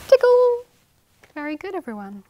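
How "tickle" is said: high, loud tickle call; playful tickle. Shown as a demonstration. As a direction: surprise the kids with a tickle. Tickle ending of rhyme.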